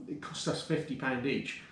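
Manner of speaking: ordinary talk, nothing marked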